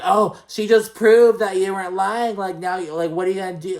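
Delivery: high, loud mocking taunt; obnoxious taunting. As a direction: mimic two loud women gloating. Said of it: "just being obnoxious".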